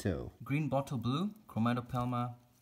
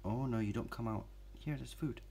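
normal talking voice

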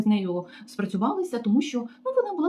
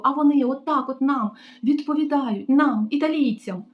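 much higher, louder hammed-up wailing; hysterical rant; 7 semitones higher, 4 dB louder than usual. Receptionist ranting about foreign staff, hysterical mock wail. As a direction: play a drama queen clutching her chest.

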